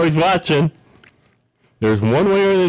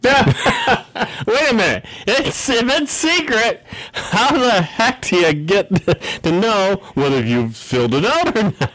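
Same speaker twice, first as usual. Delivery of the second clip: high, slow, laughing "wait a minute"; mock disbelief; 7 semitones higher, slower than usual. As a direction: play up the fake astonishment. Mock disbelief at secret survey.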